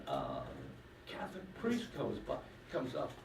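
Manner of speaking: ordinary delivery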